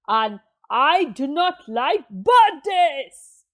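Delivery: emphatic, mildly roared list of dislikes; big indignant bear voice; roaring bear. A narrator's reading. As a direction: pound out each word as Bear. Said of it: "and roared".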